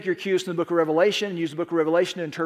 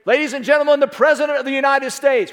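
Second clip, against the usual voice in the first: raised, louder formal announcement; agent announcing the President; 5 semitones higher, 4 dB louder than usual. Acted. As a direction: announce the head of state to the room. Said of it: "one of his agents would step in and say".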